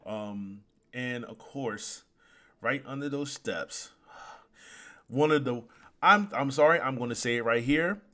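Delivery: usual speaking voice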